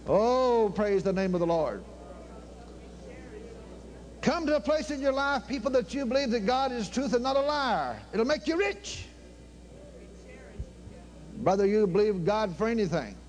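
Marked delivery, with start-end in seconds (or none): 4.2-9.1 high, ringing preacher's call; preaching. Real, not acted. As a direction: preach it out to the people. Preaching at full force, high.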